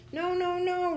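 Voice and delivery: very high, tiny character voice; little bubble protesting. A narrator's reading. Bubble refuses in story.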